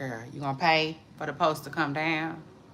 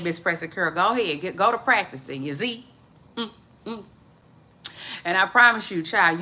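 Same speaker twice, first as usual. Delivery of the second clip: ordinary talk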